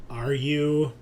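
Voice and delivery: ordinary delivery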